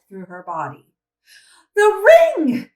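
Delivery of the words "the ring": sudden high panicked scream. Acted. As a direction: shriek it out in alarm. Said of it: "she screamed".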